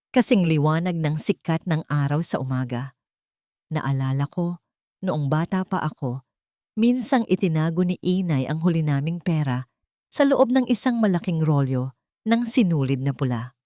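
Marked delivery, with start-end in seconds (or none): none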